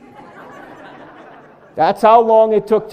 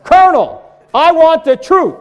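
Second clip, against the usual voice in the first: higher, louder demand for the truth; Tom Cruise shout; 9 semitones higher, 8 dB louder than usual. Retold or imitated. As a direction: call it out like the courtroom lawyer. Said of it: "Let's try that again".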